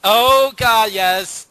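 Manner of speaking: loud, high burst of recognition; excited. Real, not acted. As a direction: shout it out, delighted and grossed out. Reacting to gross story.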